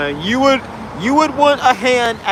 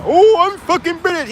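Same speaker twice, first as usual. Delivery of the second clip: mock angry Brit; 9 semitones higher, 4 dB louder than usual. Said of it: "he's getting angry now".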